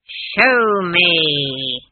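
long, loud, menacing order; commanding shout. Acted. Granny forces frightened Esk to use magic, thundering. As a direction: bellow it, stretching both words.

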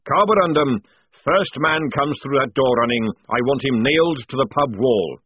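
loud sergeant's command; forceful military instruction; barked order. Acted. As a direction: order the trooper firmly and loudly. Sergeant gives orders to his squad.

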